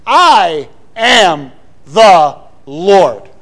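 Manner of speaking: every word stressed, very slow and loud; all caps. Shown as a demonstration. Preacher showing God's words in all caps, each word stressed. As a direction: hammer "I", "am", "the", "Lord" one at a time. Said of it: "it might be all caps".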